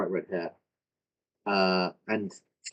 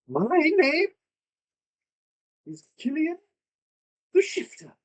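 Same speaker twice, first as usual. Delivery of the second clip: much higher, proud announcing elf voice; grand elf. Acted. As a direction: declare his name with sudden grandeur. Transformed elf announces himself.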